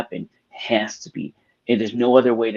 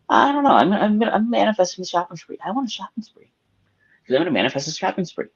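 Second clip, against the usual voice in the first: put-on high, bright-eyed little girl voice, much higher; excited daughter; 9 semitones higher than usual. Daughter telling her dad her summer plans, high eager kid voice. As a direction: play the eager kid announcing her plan. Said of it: "And she goes".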